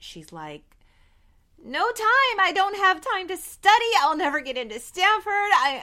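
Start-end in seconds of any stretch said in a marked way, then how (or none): 1.6-5.8 high, loud, wailing panic, acted out; breakdown. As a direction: imitate a frantic student falling apart. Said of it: "this is the breakdown moment".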